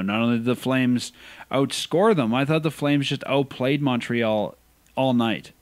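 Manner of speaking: usual speaking voice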